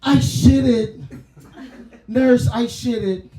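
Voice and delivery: put-on loud, high, laughing old man cry; mock old man. Old man calling to the nurse, loud and high. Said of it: "I'm telling aerobics".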